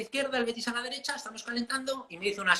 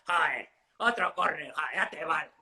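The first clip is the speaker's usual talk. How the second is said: mock insulting protest; 5 semitones lower than usual. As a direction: drag it out with contempt. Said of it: "A otro tono que te diga".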